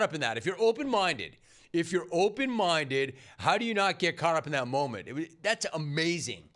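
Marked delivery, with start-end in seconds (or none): none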